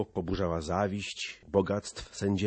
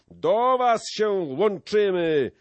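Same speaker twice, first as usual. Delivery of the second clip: slow, high, loud rallying call; drawn-out joining cry; 11 semitones higher, 8 dB louder, slower than usual. A narrator's reading. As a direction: holler your allegiance, stretched out. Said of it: "wołał Skołuba".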